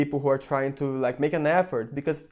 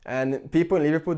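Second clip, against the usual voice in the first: normal talking voice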